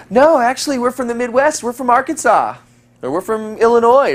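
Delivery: put-on high, bubbly girl correcting him. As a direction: lift into a giggly girl's voice.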